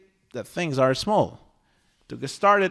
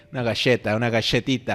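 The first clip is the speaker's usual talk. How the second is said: lower-pitched imitation of a friend's accent, galleta with a sh sound; friend Franco's pronunciation; 6 semitones lower, much faster than usual. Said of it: "my friend Franco would say".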